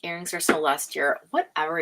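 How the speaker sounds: normal talking voice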